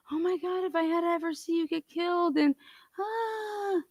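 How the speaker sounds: high, soft, whimpering imitation of a parent; mock tearful parent. Parents begging him to take the plea, mocking tearful whimper. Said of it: "your parents are begging you".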